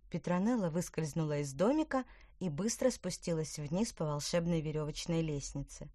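ordinary delivery, nothing marked

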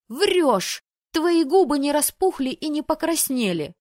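high, loud accusing shout; furious shrieking sister; angry yell. Acted. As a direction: call her a liar.

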